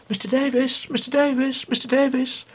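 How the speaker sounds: high-pitched, overexcited camp posh call. Retold or imitated. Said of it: "in high-pitched, overexcited, camp posh voices".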